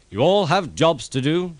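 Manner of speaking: pompous railway boss giving orders; Fat Controller. A narrator's reading. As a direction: boom it out like the boss.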